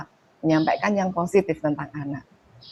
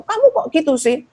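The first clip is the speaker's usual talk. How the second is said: put-on higher, louder irritated nagging at a child; exasperated parent complaining; annoyed nagging; 8 semitones higher, 4 dB louder than usual. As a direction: scold the kid like a fed-up parent. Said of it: "Biasanya kan kita kalau kesel".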